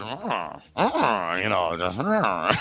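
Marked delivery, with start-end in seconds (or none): 2.0-2.6 Dixon impression. Doing his Dixon impression for the hosts, shrieking laugh. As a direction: grunt into a wild shrieking laugh. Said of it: "my Dixon impression".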